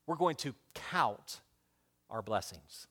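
soft, slow, low key point; quiet emphasis. Shown as a demonstration. Preacher giving his first key, soft and slow. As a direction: lay the phrase down gently. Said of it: "Everybody say that with me".

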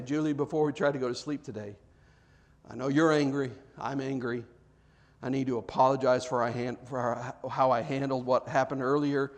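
low, soft, contrite husband; sincere apology. Acted. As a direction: own up gently to your wife. Models a husband's bedtime apology to wife.

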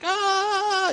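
high startled gasp "ah"; a sudden high "ah". Retold or imitated. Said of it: "Everybody's like".

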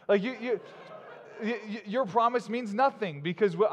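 stammering through real laughter; laughing. Real, not acted. Preacher laughing at empty movie promises, laughing. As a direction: laugh while you mock it.

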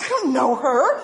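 high, loud mock outrage; hammed-up affronted protest; jealous indignation. Jealous mother about the babysitter's sister, indignant. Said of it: "I was jealous and I was envious".

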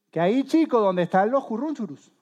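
high, loud retort; exasperated spouse. Acted. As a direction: snap back at a clueless spouse. Acts out exasperated spouse's answer.

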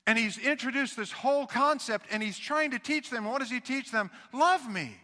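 ordinary talk

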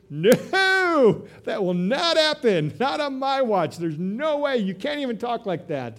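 high, loud indignant outburst; Peter's protest. Retold or imitated. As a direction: cry it out as the brash disciple refusing. Peter voiced protesting Jesus.

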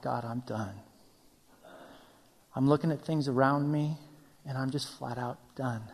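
low, soft acted defeat; drained, like someone giving up in prayer; exhausted. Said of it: "chased to the point of exhaustion".

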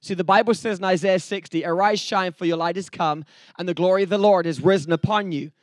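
ordinary talk

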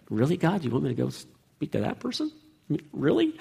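mock doubtful pleading with God; incredulous reluctance. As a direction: imitate a reluctant believer, hushed and disbelieving. Voicing a reluctant believer questioning God.

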